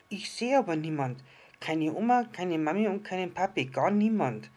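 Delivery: put-on loud, whiny tired child; whining. A narrator's reading. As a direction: whine like a hungry, tired kid. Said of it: "quengelte das kleine Sterndl". (quengelnd und laut wie ein müdes Kind)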